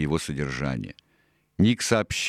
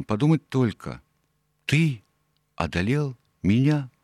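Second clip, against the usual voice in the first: higher voice, halting in amazement; sighing disbelief; 7 semitones higher than usual. A narrator's reading. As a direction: marvel at being beaten, word by word. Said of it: "вздохнул он". (поражённо, с паузами, высоким голосом)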